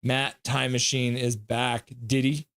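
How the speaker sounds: ordinary talk, nothing marked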